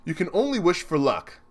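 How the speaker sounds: normal talking voice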